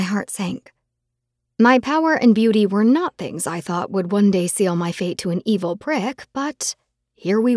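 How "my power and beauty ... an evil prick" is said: ordinary delivery, nothing marked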